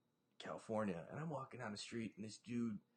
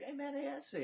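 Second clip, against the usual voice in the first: much higher, lightly voiced stranger; a hint of a wary stranger; imitating a guy; 12 semitones higher than usual. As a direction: mimic the guy calling out. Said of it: "He's like".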